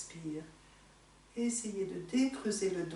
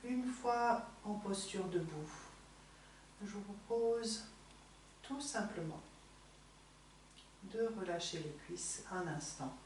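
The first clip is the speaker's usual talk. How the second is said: softer, fading gently to a hush; soft relaxing guidance; 10 dB quieter than usual. Real, not acted. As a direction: let the voice sink into calm. (voix douce qui s'éteint)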